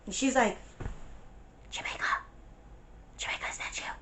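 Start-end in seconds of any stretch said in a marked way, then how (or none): 1.7-3.9 soft whispered question; hushed friend answering through the window; whispered reply. Retold or imitated. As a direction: whisper back her name.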